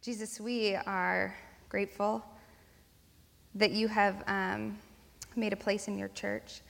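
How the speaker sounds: quiet, low, unhurried prayer. Real, not acted. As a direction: pray softly with pauses between phrases. Leads a prayer.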